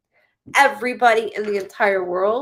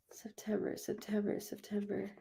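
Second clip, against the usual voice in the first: murmured to herself; 15 dB quieter than usual. Real, not acted. Musing over a reading.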